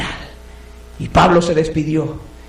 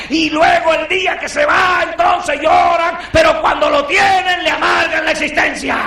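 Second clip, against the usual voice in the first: forceful preaching; 9 semitones higher than usual. Acted. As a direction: rebuke the ungrateful churches. (sermón a gritos, con reproche)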